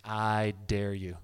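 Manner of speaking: slow, low, deliberate challenge; slow dare. Real, not acted. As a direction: lean in and dare him slowly.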